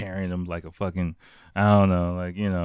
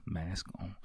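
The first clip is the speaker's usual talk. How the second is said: much softer, secretive repeat; hushed echo; 13 dB quieter than usual. Real, not acted. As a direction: drop it right down like a stealthy aside. Repeats phrase as ninja aside.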